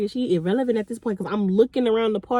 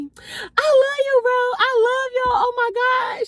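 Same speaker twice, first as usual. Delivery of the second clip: put-on drunk friend; 12 semitones higher than usual. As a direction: stretch it out high and weepy, wasted and loving. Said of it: "Then she see somebody".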